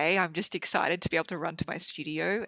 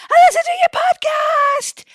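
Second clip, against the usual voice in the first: yelled out a car window, much higher and louder; excited shout; 15 dB louder than usual. Retold or imitated. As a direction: shout it from a passing car, thrilled. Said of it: "screeched to a halt beside me".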